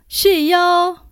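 shouted name, pitched high; loud call. A narrator's reading. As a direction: call out loudly into the room. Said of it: "大声叫".

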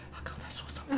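hushed, voiceless aside; whispering. Retold or imitated. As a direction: whisper it to the colleague beside you. Asking a colleague during a meeting.

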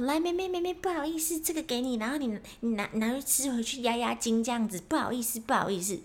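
high, coaxing apologetic voice; sheepish driver. Retold or imitated. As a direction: soothe the girl while pushing the gift. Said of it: "然后说".

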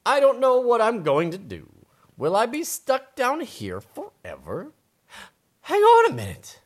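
worried mole. Acted. Mole stuck underground spotting a light, high dramatic wondering. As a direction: play a mole at a loss, pitched high. Mole's lines in story.

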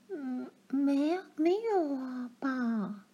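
high, soft, nervous denial; timid denial. A narrator's reading. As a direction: answer meekly, afraid of being caught. Daughter denies to stern father.

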